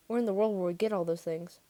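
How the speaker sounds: ordinary delivery, nothing marked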